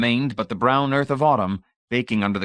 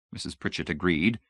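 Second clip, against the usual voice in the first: usual speaking voice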